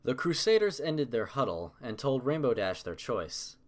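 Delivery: plain reading voice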